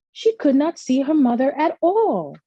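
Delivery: plain reading voice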